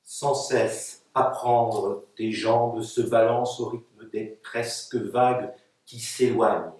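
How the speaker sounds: measured, articulated recitation of a poem; poetry reading. Acted. Poet reading her text to an audience, measured recitation. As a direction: recite it line by line, with pauses. Poets read their texts aloud.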